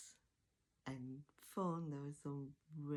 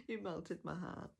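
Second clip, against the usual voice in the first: ordinary talk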